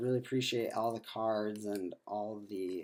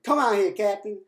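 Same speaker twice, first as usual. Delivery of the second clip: imitating a sassy attendant, much higher and louder; 10 semitones higher, 14 dB louder than usual. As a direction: toss it at the captain with mock sass.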